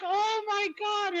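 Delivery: high, drawn-out mock astonishment; acted stunned reaction; retold amazement. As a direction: stretch it out slowly, up high, imitating shock. Retelling reaction to daughter's outburst.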